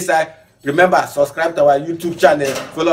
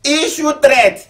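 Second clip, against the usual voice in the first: high, slow, shouted headline; incredulous shout; 12 semitones higher, 4 dB louder, much slower than usual. Real, not acted. Host announcing sex worker strike, slow high shout. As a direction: drag out the words in disbelief.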